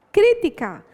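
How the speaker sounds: "crítica" dito mais alto e agudo, destacado como palavra-chave; ênfase em crítica. Shown as a demonstration. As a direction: destaque a palavra "crítica" sozinha, com queda no fim. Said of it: "A palavra-chave". (professora destaca a palavra-chave "crítica" para os alunos)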